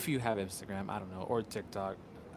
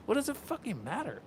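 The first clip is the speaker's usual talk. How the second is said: high-pitched indignant question; exasperated comeback; 10 semitones higher than usual. Retold or imitated. Answering commenters who ask why he laughs, exasperated. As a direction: throw it back, high and exasperated. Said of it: "I'm like".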